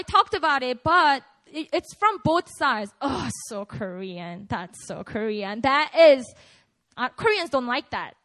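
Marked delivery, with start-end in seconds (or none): 3.0-5.6 slow, lower, scornful drawl; mock disdain. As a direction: sneer it out slowly. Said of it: "when you talk like that".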